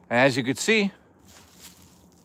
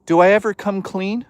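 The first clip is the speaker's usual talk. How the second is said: normal talking voice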